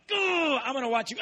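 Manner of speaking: high, mocking jeer; gloat, then get cut off; gleeful taunt. Preacher gloating at the stinging insect, high mocking jeer.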